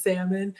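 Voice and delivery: "salmon" with a silent L, SAM-un; salmon without the L. Retold or imitated. Host reading a viewer's vote, salmon without the L. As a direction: skip the L in "salmon". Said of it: "if you pronounce salmon without the L".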